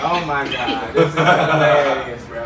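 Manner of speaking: slow, laughing through the words. Retold or imitated. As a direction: crack up while saying it. Laughing at the funeral story.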